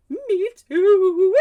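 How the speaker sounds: high, loud commiseration; mock wail. Answering friend's sore-throat call, hammed-up loud wail. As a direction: wail along in exaggerated misery.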